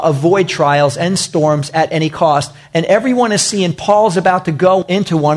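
ordinary delivery

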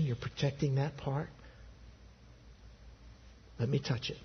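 soft, low pleading with long pauses; hushed, intimate invitation; tender appeal. Acted. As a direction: ask gently, leaving space after each phrase. God's tender appeal to listeners.

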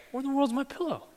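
high puzzled muttering in the dark; high bewildered murmur at night; baffled. Retold or imitated. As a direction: wonder aloud, high and lost.

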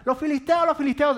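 high, loud cry of warning; urgent high-pitched yelling; alarmed shout. Acted. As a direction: shout the alarm as if enemies burst in. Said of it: "Dalila grita".

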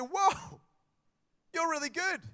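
high, loud and astonished; amazed. Retold or imitated. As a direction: gasp out praise, blown away.

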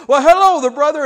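high, loud hearty hello; hearty greeting. Retold or imitated. Deacon greets his church buddy in the lot, hearty. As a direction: greet a church friend with gusto. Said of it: "one of the deacons ... says".

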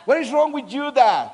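high, loud mock-upset child; angry toddler. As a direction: play a cross little boy. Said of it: "he will be all angry with me".

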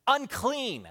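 high, drawn-out warning call; warning cry. Acted. Lepers warning anyone who comes near, acted yell. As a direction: call it out to keep people away. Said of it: "they would have to yell out".

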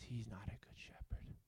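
hushed voice of inner doubt; whispered doubt. Retold or imitated. Pastor voicing the little voice of doubt, whispered. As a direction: whisper it like a tempting voice. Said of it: "that little voice says".

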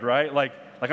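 normal talking voice

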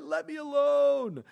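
high, slow, drawn-out plea to be left alone; fed up plea. Acted. Job begging his friends to leave him be, fed up. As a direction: stretch it out, worn down and fed up. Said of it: "he's just so fed up".

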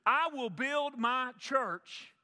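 very slow, weighty words of Jesus; majestic quote. Acted. Preacher giving Jesus' promise to the church, very slow. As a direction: lay each word down deliberately.

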